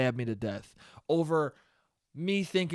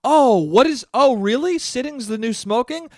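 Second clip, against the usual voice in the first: higher-pitched mock amazement; gullible reader; 6 semitones higher, 5 dB louder than usual. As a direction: play a credulous reader swallowing a headline. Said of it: "get stupid people to be like".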